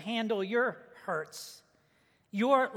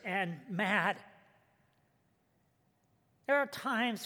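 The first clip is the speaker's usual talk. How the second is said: soft, hushed pastoral prayer, softer and lower; gentle closing prayer voice; 3 semitones lower, 3 dB quieter than usual. Acted. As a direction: pray it quietly and humbly to God. Pastor leads opening prayer.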